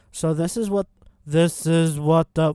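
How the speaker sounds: crushed mic tone; distorted vocal effect. Real, not acted. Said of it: "why do I sound so distorted".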